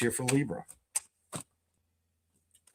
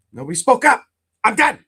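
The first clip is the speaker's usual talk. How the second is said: louder and higher, fed up; exasperated outburst; 7 semitones higher, 9 dB louder than usual. Real, not acted. Reader to Libras, fed up and loud. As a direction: throw up your hands, done with it.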